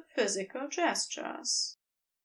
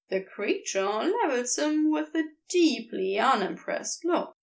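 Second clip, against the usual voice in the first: ordinary delivery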